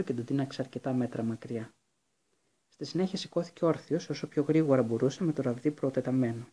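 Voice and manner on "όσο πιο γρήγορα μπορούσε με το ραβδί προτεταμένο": ordinary delivery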